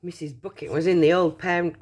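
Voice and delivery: ordinary delivery, nothing marked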